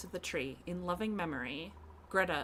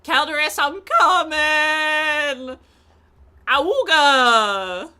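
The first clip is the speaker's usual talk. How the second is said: much higher, much louder whooping; excited yelling; 9 semitones higher, 18 dB louder, slower than usual. Real, not acted. Streamer racing to Calderas, gleeful yelling.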